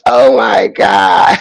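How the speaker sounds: slow, low, wailing cry; mock sobbing. Hamming up tears at sentimental players, a mock sob. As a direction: fake a big blubbering sob.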